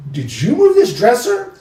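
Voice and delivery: high-pitched, incredulous demand; retold startled question. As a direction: question them in mild alarm. Retold accusing question at night.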